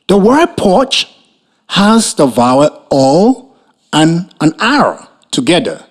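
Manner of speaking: normal talking voice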